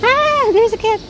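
ordinary delivery

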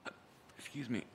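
hiccuping while talking; hiccups. Real, not acted. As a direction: talk through the hiccups. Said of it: "I have the hiccups".

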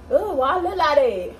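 ordinary talk

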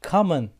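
Common said as amın; English word sounded out for the class; model pronunciation. Shown as a demonstration. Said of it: "come şeklinde bunlar da okunuyor".

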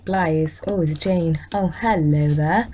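ordinary talk

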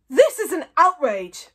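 loud, slow outraged boom. A narrator's reading. Mogadon protesting the stolen book, booming. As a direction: boom it out indignantly. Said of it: "boomed Mogadon".

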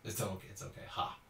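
ordinary delivery

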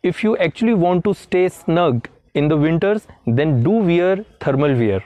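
very slow and deliberate English; slow example sentence. Shown as a demonstration. Teacher giving viewers an example, very slow. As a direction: drawl the example out for learners. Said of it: "For example".

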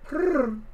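high purring trill; cat purr. A narrator's reading. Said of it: "said Harriet".

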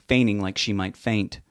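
ordinary delivery

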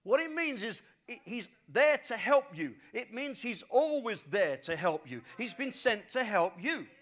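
loud, driving sermon delivery; preaching at full force. Real, not acted. Pastor urging listeners that the Spirit helps them, loud. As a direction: press the promise home to the crowd.

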